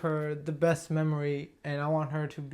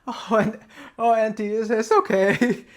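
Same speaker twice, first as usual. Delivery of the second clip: higher and louder, voice cracking while comforting; 6 semitones higher, 8 dB louder than usual. Retold or imitated. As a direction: push through the tears, voice breaking on the words. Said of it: "you would hear this voice crack coming out of me".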